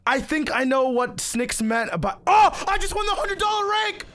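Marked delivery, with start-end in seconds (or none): 2.3-4.0 high, loud thrilled shout; shrieking jackpot winner. Real, not acted. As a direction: scream it like you hit the jackpot. Just won a rare prize.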